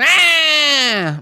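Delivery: high, loud exasperated holler; mock grouchy cry, high and loud; grumpy old man's yell. Preacher imitating a grumpy old man, wordless yell. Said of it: "he sounds like a grumpy old man".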